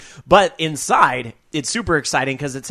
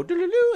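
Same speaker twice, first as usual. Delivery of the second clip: hummed jingle. Retold or imitated. Imitates interruption jingle sound.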